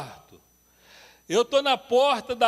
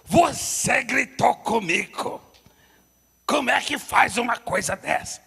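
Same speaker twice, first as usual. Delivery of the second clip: accusing; 3 semitones higher, 5 dB louder than usual. Retold or imitated. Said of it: "no mesmo tom de voz".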